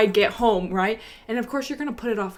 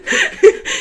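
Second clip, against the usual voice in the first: high squealing laugh; 9 dB louder than usual. Real, not acted. Laughing at her fall.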